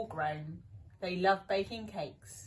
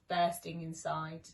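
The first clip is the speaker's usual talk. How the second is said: ordinary delivery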